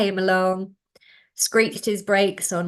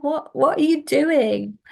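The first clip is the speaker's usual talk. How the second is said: tearful plea; 7 semitones higher, 4 dB louder, slower than usual. Retold or imitated. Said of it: "go home in tears and say".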